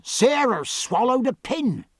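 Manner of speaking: high, drawn-out cry of alarm; slow, high-pitched distressed call; urgent cry. A narrator's reading. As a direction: wail the bad news, pitched right up. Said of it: "cried Jemmy Ducks, coming after the run".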